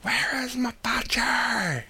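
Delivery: high, strained, drawn-out mock scream; imitated Angel's scream. Quoting Angel's great line from the film, imitated scream. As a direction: scream it high, stretching every word. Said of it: "where Angel actually screams".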